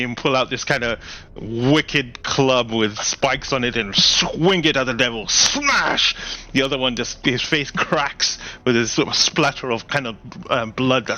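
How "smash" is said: high burst of sound effect; smash. A narrator's reading. Game master punching the club strike, high and sharp. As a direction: slam the word like the hit.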